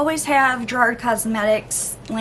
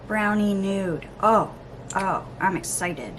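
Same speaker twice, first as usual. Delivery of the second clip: normal talking voice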